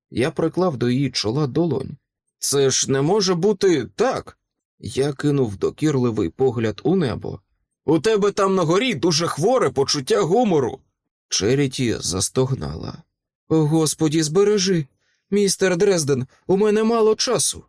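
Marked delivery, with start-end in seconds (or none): 2.4-4.4 higher-pitched indignant disbelief; exasperated protest. Acted. Protesting disaster to heaven. 7.8-10.8 high, exasperated complaint; indignant reproach to heaven. Acted. Scolding God in the sky. 13.5-17.6 high-pitched alarm of a woman in labour; urgent plea. Acted. Labouring woman urging haste.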